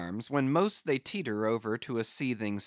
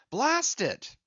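high sharp curse; angry cry; 12 semitones higher than usual. A narrator's reading. Stubb cursing his jammed hand, high. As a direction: spit out the curse. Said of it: "cried Stubb".

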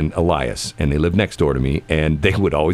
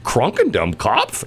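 incredulous echo; 6 semitones higher, slower than usual. Retold or imitated. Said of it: "And then they'd laugh".

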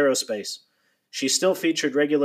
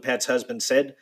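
ordinary delivery

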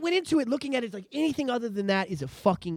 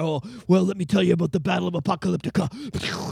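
lightly voiced storyteller, pitched lower; 5 semitones lower than usual. Mimicking movie characters telling a story, light storyteller voice. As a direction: slip into a mock tale-teller's voice. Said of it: "like, people telling a story".

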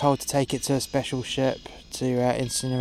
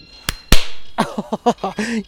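hearty laugh; 8 semitones higher, 9 dB louder than usual. Acted. Laughs at own joke.

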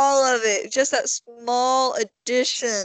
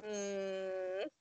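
very soft, low hum; barely answer, half asleep; sleepy grunt; 3 semitones lower, 17 dB quieter than usual. Retold or imitated. Daughter ignoring dad, low sleepy hum. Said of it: "And I'm like".

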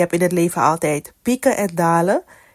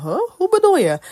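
confused surprise; 12 semitones higher, faster than usual. Retold or imitated. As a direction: sound totally thrown by the news. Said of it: "De discipelen hadden zoiets van".

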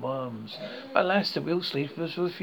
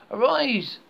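higher, louder gibbering cry; gibbering command; 8 semitones higher, 5 dB louder than usual. A narrator's reading. Ghost grabs the sleeper's wrist, gibbering higher command. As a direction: bark the order to rise. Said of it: "the gibbering voice said again".

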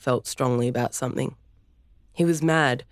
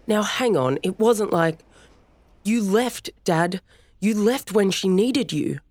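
heated argument; 6 semitones higher than usual. Acted. Storm arguing with dad.

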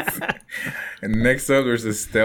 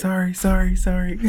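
apologetic character; 9 semitones higher, 4 dB louder, faster than usual. Acted.

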